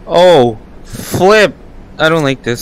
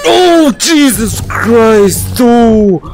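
startled yell; 3 semitones higher, much slower than usual. Real, not acted. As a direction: yell out in shock, stretching every oh. Jump scare in horror game.

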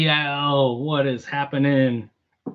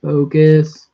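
Focus said louder, firm and impatient; exasperated louder Focus; louder command; 10 dB louder than usual. Real, not acted. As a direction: bark Focus at the stubborn camera. Urging camera to focus, repeated.

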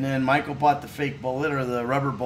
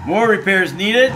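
mounting mock exasperation; 4 semitones higher, 7 dB louder than usual. Repeating the prompt, louder still.

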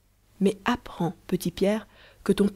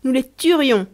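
higher, louder boast; proud, heroic; 6 semitones higher, 7 dB louder than usual. A narrator's reading. Boy swelling with pride before Marie, heroic boast. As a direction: puff up like a little hero. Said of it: "enorgueilli en prenant une pose héroïque".

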